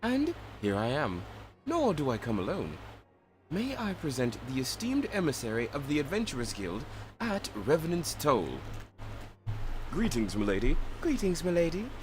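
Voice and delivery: formal, lilting feminine voice; courteous emissary with swooping intonation; Minfilia-like female game character. Acted. Presenting an Adventurers Guild emissary to a lady, formal female voice.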